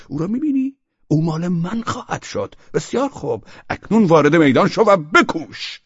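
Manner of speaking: high mock-taunting voice; a hammed-up gloat; taunting quote. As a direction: sneer it like a gloating rival. Mocking the rival's taunt.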